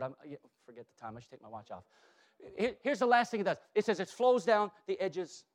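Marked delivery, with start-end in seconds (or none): 0.0-1.8 much softer, lower, quick throwaway; soft quick self-aside; muttered aside. Real, not acted. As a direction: mumble it to yourself. Aside about time.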